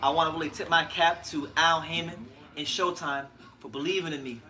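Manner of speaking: usual speaking voice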